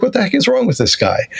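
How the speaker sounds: mock disbelief at salesman; incredulous customer. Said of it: "You'd be like".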